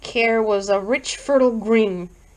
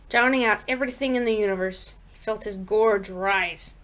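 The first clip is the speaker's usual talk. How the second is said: plain reading voice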